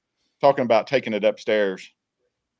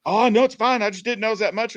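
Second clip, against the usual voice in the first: dad backpedaling; 11 semitones higher than usual. Retold or imitated. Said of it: "And he goes".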